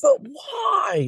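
high, incredulous cry; real exasperated outburst; exasperated disbelief. Real, not acted. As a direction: exclaim it high and loud, truly baffled. Exasperated at people put off plants.

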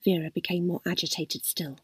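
ordinary delivery

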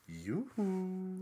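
lilting creepy sing-song call; sing song yoo hoo. Retold or imitated. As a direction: coo it like a creepy stalker. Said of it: "he just goes".

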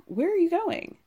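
high, startled question; lightly voiced surprised wife. Voicing Robin's surprise.

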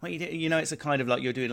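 normal talking voice

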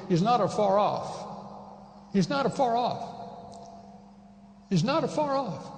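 "not" hit harder each repeat, climbing in pitch; building emphasis. Shown as a demonstration. Preacher driving home God's nearness, building emphasis. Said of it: "He's not somewhere else".

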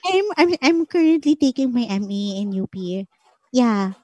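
imitating a flirty sweet-talking voice. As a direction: play up the cutesy flirting in a light imitation. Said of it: "Sino yung nagsasalitang ganun?".

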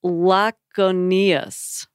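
"Laconius" slowly sounded out; laboured pronunciation. Real, not acted. Host attempting a hard name, "Laconius" slowly sounded out. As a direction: draw out "Laconius" piece by piece, unsure of it. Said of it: "there's some I can't pronounce".

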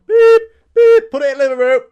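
very high, loud beep beep then mock announcement; forklift beeping. Dad bringing a skip in on a fork truck, beeping. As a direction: honk like a reversing truck.

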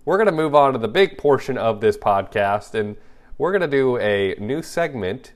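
podcast host hyping the new segment, announcer voice. Acted.

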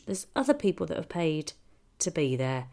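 ordinary delivery

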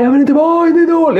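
high-pitched mock protest; hammed-up childish objection; whiny child's refusal. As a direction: imitate a kid whining back.

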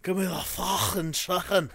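mock Scouse catchphrase breaking into a laugh; Scouser impression. Shown as a demonstration. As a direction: put on a throaty caricature Scouser. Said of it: "to do a Scouser impression".

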